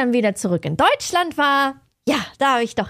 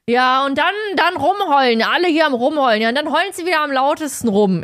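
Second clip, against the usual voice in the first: mock angry official, louder and higher throughout; rude scolding; 4 semitones higher, 5 dB louder than usual. Security worker berating the traveler for complaining, mock loud scolding. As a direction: scold the passenger for whining, rough and loud. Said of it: "assi einfach".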